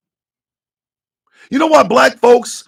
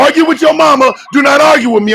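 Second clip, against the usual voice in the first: loud, high, heated rant; fired-up shout, higher and louder; 6 semitones higher, 4 dB louder, faster than usual. Real, not acted. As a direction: yell it at the doubters. Ranting at doubters, shouting.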